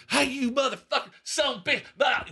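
spew curses like the irate fan; imitated swearing rant. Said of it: "Every word out of his mouth was a swear word".

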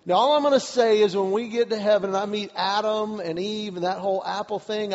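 usual speaking voice

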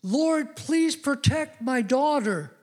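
high, slow, pleading prayer; a worried father pleading with God at one in the morning; anxious father's plea. Retold or imitated. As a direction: beg God to keep her safe. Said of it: "I prayed this prayer as a father".

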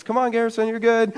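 high-pitched, soft reassurance; sister encouraging. Retold or imitated. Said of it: "You know, my sister's".

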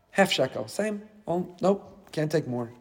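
soft, offhand turning-down; understated refusal. Acted. Collector turning away a bigger donation, in a soft offhand refusal. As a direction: drop right down, politely declining.

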